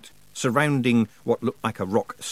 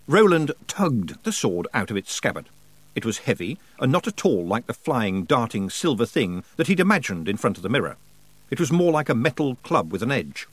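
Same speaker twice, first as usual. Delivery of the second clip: ordinary talk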